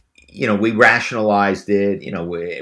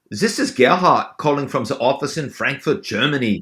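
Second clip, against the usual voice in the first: put-on German accent, pitched up; Gerhard, German accent; 5 semitones higher than usual. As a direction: play a caller with a crazy German accent. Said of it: "we all had accents that we".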